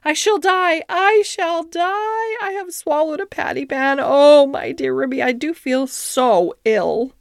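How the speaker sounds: high, drawn-out wail of woe; hammed-up howl, easing down in pitch. A narrator's reading. Duchess thinks she swallowed a patty pan, howling. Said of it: "Duchess set up a howl".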